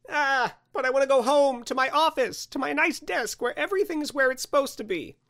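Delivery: high, plaintive whine; pleading Andrew. Acted. Stranded man begs Santa to go home, high pleading voice.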